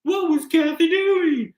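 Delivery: very high, slow mock-clueless voice; high-pitched, hammed-up feigned ignorance; pretend amnesia. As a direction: squeal in exaggerated fake innocence, drawn out. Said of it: "Crystal has pretend amnesia".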